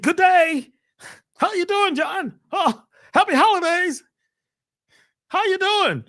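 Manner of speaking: mock the fake-intelligent work voice; mimicking a coworker's stiff, lipless greeting; stiff office voice. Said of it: "act like you don't have any lips".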